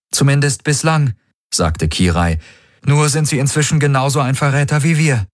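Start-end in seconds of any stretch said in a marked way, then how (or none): 0.1-1.1 deutlich höher, schnippisch; spitze Kirai. Acted. Kirai voiced higher. 2.8-5.3 deutlich höher, schnippisch; spitze Kirai. Acted. Kirai voiced higher.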